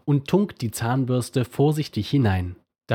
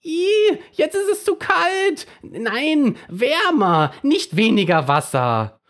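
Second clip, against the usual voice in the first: complaining toothbrush; 5 dB louder, slower than usual. Acted. Toothbrush complains about water temperature.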